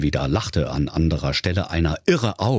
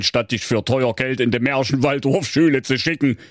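a hint of a higher, exasperated father; scolding father; 6 semitones higher than usual. A narrator's reading. Father Wackelbein scolding Thorsten and sending him away, exasperated. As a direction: lecture your son, fed up.

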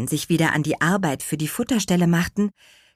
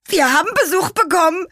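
high, loud, hurried burst; blurted out; 13 semitones higher, 5 dB louder, faster than usual. A narrator's reading. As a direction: burst out with the news at speed. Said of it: "platzte Karottenwams ohne Umschweife heraus".

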